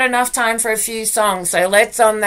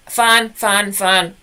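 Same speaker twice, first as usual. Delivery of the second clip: ordinary delivery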